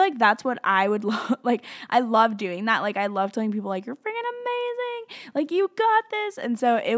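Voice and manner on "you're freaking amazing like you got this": high squealed pep talk; gushing encouragement. Retold or imitated. Telling people words of affirmation, excitedly encouraging. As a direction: cheer them on in a much higher voice.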